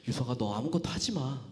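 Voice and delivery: lightly voiced gentle instruction; Jesus reassuring. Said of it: "예수님이 저에게 이렇게 말씀하셨어요".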